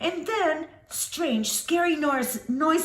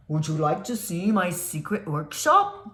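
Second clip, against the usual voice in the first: low man voice; 9 semitones lower than usual. Acted.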